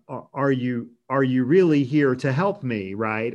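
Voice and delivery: normal talking voice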